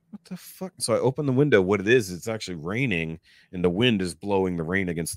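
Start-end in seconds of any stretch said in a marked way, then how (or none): 0.1-0.7 very soft, high-pitched disbelief; hushed bewilderment. Retold or imitated. Dreamer finds the room rearranged, hushed and bewildered. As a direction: breathe it out softly, baffled.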